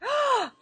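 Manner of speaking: high, quick gasp; shocked gasp. Real, not acted. Daughter hearing of the family prank, shocked gasp. Listener shocked by cold water story.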